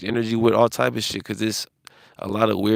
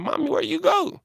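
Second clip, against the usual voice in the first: mimicking a small child in a much higher voice; little kid. Retelling the child calling for its mother, much higher mock child voice. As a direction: pipe it up like a lost toddler. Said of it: "and the kid's like".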